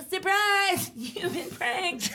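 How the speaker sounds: high, loud sing-out reveal, drawn out at the end; prank reveal. Retold or imitated. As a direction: announce it like a mock-gleeful TV reveal. Imitating her prank reveal to the target.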